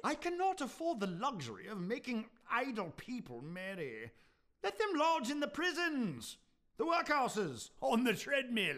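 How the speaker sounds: rising scorn. Acted. Scrooge refusing charity, rising anger.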